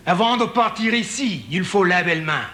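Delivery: lightly put-on French reading of a sign; mock French. Comedian reading a Paris sign in mock French. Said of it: "the French one".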